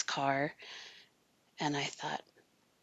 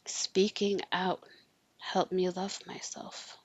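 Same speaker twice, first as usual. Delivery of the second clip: ordinary talk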